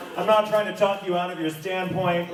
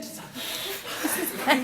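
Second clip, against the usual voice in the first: high, slow, gushing with laughter; giddy drawn-out delight; delighted squeal; 13 semitones higher, 5 dB quieter, much slower than usual. Real, not acted. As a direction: swoon over the gift. Gushing over a scented gift.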